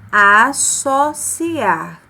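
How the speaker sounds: associar drawn out slowly with a clear hissing ss; slow demo word. Shown as a demonstration. Teacher modelling the word for pupils, very slow. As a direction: stretch each syllable of associar, sharp s. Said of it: "Ele aparece com som de S".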